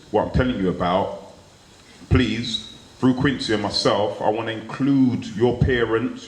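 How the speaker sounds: ordinary delivery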